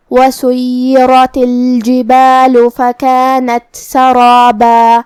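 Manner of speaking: slow, melodic tajweed chant; Quran recitation. Acted. Teacher recites Surah An-Naba for listeners, melodic chant.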